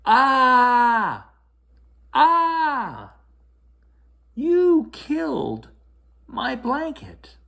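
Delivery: very high loud wailing cry, slowing at the end; child's tantrum wail. A narrator's reading. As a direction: scream and sob at the brother. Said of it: "Taylor screamed louder".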